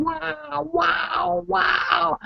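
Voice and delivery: marveling at the lovely day; repeated wow. Retold or imitated.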